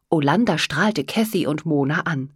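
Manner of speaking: ordinary delivery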